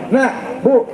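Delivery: ordinary talk, nothing marked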